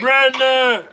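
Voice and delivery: loud high call of a name. Real, not acted. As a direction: call the name across the room. Calling out a name.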